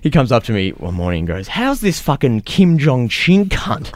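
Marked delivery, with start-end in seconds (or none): 1.5-3.9 high, slow, drawn-out oafish voice; hammed-up crude workmate; mock bogan coworker. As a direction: stretch it out like the dim coworker. Coworker's crude remark quoted.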